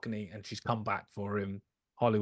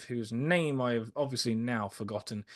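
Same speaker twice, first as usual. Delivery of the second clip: ordinary delivery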